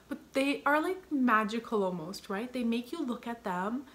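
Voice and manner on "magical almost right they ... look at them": usual speaking voice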